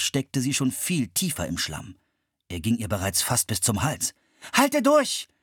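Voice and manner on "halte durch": loud, high call of encouragement. A narrator's reading. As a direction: urge her to hold on. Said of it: "rief Philipp".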